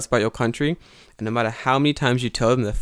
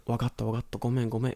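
much softer resigned apology; mock weary apology; 9 dB quieter, faster than usual. Teacher voices Tanaka sensei giving a tired apology. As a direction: mumble it giving up. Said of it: "he was like".

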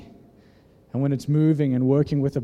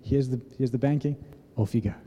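soft, low, gentle send-off; retold gentle voice, dropping low; gentle dad; 4 semitones lower, 7 dB quieter than usual. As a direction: imitate a father quietly handing over a chore. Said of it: "he just, he very gently was like".

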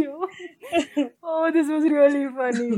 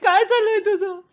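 mock indignant dad, higher pitched; imagined angry uncle; 7 semitones higher, 4 dB louder, much faster than usual. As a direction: act out a worked-up father through laughter. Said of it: "I'm still imagining uncle. He's like".

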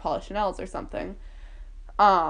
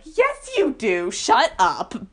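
mock retort; 3 semitones higher, 5 dB louder, much slower than usual. Dance friend contradicting her about crying, mock retort. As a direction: call her out loudly, stretching the words.

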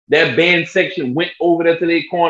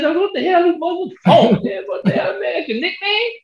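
high, puzzled disbelief; confused surprise; 13 semitones higher than usual. Retold or imitated. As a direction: ask it baffled, voice jumping up. Confused reply to the nickname.

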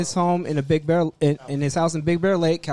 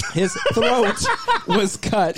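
laughing; 4 semitones higher, slower than usual. Real, not acted. Laughs mid news read.

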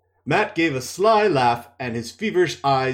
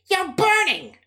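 much higher worried woman; alarmed healer, pitched way up; Nynaeve's voice; 3 dB louder than usual. Acted. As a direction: Nynaeve feeling Matt's fever, much higher. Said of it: "she said".

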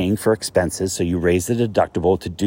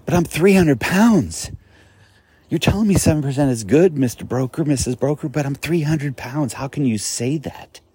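exasperated client; 7 semitones higher than usual. Pretend. Client challenging the broker, incredulous and pleading higher. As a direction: play the overweight client calling out his broker. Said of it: "You should be saying".

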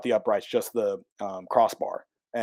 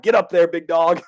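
loud hearty bro call; fan's encouragement; 5 semitones higher, 8 dB louder than usual. Retold or imitated. A fan urges him onto the goalpost, loud hearty call. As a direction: holler him up like a buddy. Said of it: "one guy's like".

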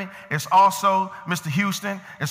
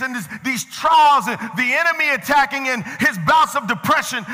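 higher, rolling preacher cadence; fervent preaching; 7 semitones higher than usual. Real, not acted. Preacher telling how King David looks back on life, fervent preaching. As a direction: drive each phrase home to the congregation.

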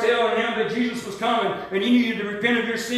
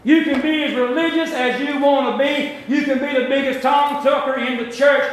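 louder, pressing sermon voice; fervent preaching; 4 semitones higher, 4 dB louder than usual. Real, not acted. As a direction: drive it home to the church. Preaching builds again.

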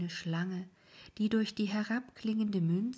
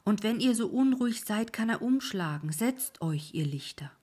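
louder, higher ferryman rebuke; scolding old ferryman; 4 semitones higher, 6 dB louder than usual. Acted. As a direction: warn the restless lights. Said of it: "rief der Alte".

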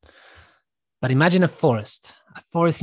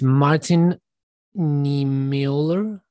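drawn-out, tentative attempt at a name; unsure pronunciation; much slower than usual. Real, not acted. Speaker attempting a hard German name, unsure and drawn out. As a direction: feel slowly through an unfamiliar name. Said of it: "I can never pronounce this person's name".

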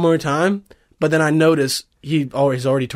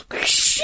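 unvoiced blade swish; sword being drawn. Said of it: "And he goes".

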